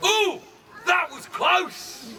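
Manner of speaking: high drawn-out "Ooh", then a slow "that was close"; dramatic near-miss reaction. Shown as a demonstration. Archer shows the crowd how to react, drawn-out and high. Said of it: "What you can do is this and go".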